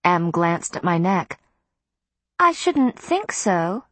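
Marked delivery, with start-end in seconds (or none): 2.4-3.8 slow, high and considering; slowly. A narrator's reading. Miriam pondering whether Matthew will bite, slow and high. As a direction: draw it out as you weigh the question. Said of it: "Miriam said slowly".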